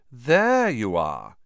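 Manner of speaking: slow, lazy drawl. Acted. Malfoy greets Crabbe and Goyle in a slow drawl. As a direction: stretch it out, bored and superior. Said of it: "he drawled".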